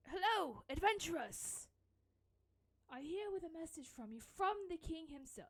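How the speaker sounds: loud, high hearty dwarf herald; dwarf messenger voice. Acted. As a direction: boom out the greeting like a proud dwarf. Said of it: "Fuck, I lost the voice".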